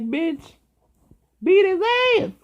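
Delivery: high, loud shriek; hyped-up yell; shouted urging. Retold or imitated. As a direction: scream at the mother to hit him. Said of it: "I'm like".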